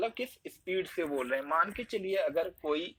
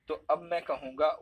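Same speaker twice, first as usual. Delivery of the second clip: ordinary talk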